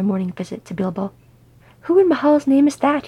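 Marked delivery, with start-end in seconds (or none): 1.8-3.1 high, loud, indignant snap; snapped. A narrator's reading. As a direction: demand an answer sharply, voice raised. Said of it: "he snapped".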